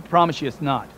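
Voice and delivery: ordinary delivery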